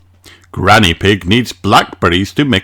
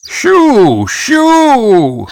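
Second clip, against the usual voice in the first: much higher, shooing call; Grandpa shooing birds; 4 dB louder than usual. A narrator's reading. Grandpa shooing a bird off his seeds, high calls. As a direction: chase the little bird off the seeds. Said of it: "says Grandpa".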